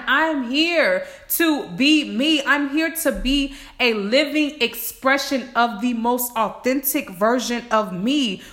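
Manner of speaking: usual speaking voice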